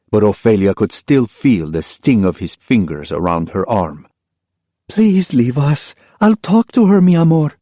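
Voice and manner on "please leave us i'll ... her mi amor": wife gently asking him to leave; tender mother. Acted. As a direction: soothe your husband gently. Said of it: "the tenderness in her mother's voice".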